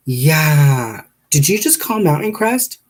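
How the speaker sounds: loud, high receptionist impression; drawn-out yeah, then high questioning; suspicious receptionist. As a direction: imitate a suspicious call handler. Receptionist voiced in retold call.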